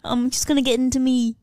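hammed-up English vampire voice; mock English accent. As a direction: play a silly English vampire. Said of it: "now you're English".

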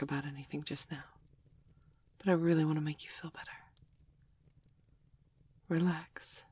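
soft whisper fading toward silence; ASMR whisper. Acted. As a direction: lull a friend to sleep in a whisper. Comforting a friend to sleep.

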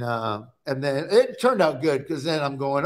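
ordinary talk, nothing marked

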